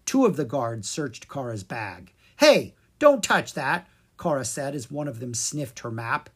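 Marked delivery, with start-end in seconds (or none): 2.4-3.9 alarmed protest. Acted. Troll sniffs Kara's map.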